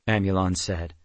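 ordinary delivery

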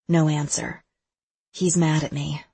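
ordinary delivery, nothing marked

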